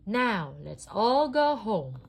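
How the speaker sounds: loud, slow, beaming call; Woody calling the gang home, grinning; happy cry, grinning. A narrator's reading. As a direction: grin as you say it. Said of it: "Woody cried, grinning".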